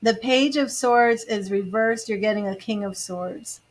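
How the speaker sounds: normal talking voice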